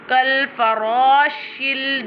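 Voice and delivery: slow "kal farashil" with a heavy, held ra; thick ra. Shown as a demonstration. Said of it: "always pronounced with a thicker voice".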